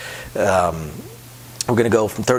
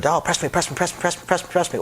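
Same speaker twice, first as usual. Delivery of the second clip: pushy player chanting, higher pitch; mock impatient player; 7 semitones higher, faster than usual. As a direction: nag the dealer like an impatient gambler. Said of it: "don't start shouting at the dealer".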